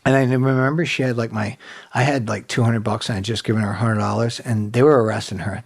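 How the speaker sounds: normal talking voice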